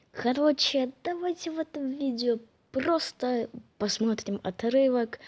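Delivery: ordinary delivery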